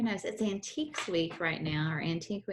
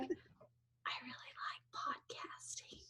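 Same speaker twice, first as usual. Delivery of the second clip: whisper; 4 semitones higher, 17 dB quieter, much slower than usual. Retold or imitated. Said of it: "It was sweet because you're like".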